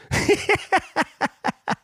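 high-pitched staccato giggle; laugh. Real, not acted. Telling of the annoying hipster, real high giggle.

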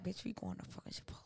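put-on bossy boyfriend's whisper; whispered order. Boyfriend giving the side eye over dinner, whispered order. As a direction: whisper it like a command.

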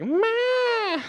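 high bleating goat cry; a high, crying kid goat's meh; baby goat cry. Shown as a demonstration. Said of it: "That's how it sounds".

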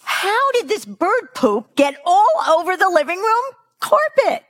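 high-pitched, hammed-up outraged yell; mock a mother shrieking in dismay; imitated shout. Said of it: "My client Hannah shouted".

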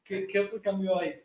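normal talking voice

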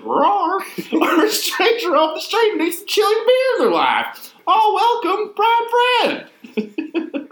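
high, loud westerly Texan prospector; old prospector voice. Acted. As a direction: read it like an old Texas prospector. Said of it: "reading it in that stupid voice".

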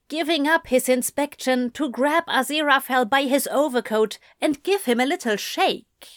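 usual speaking voice

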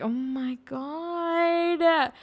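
slow, high drawn-out gasp of disbelief; shocked. Retold or imitated. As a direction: stretch it out in horror. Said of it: "I'm like".

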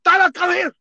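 very high, loud yell of the name; yelled summons. Retold or imitated. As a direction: bellow it like a charging sergeant. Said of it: "he's like".

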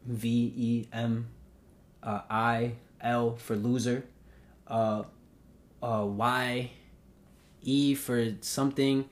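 slow letter-by-letter retold spelling; deliberate, drawn-out spelling for an officer. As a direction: spell it slowly, one letter at a time. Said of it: "I start spelling it out".

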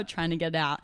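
normal talking voice

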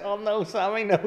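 high mock plea; a high playful protest; mock pleading May. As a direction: beg him to stop, hammed up. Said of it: "she's like".

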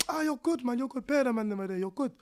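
high, upbeat reassurance; reassuring youth voice. Retold or imitated. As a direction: cheer him up like a mate. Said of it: "He's like".

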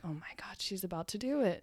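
hushed, voiceless aside; whispered dread. Retold or imitated. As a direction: whisper it as if he mustn't hear. Dreading aside during tense moment.

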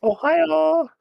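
put-on very high squealed anime girl Ohio; excited anime fan. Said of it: "say it like you're a little anime girl".

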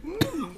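squeaky "mm" to clear the throat; tiny falsetto throat-clear; imitating a squeaky attempt to clear the throat; high-pitched throat clearing. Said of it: "he tries clearing his throat".

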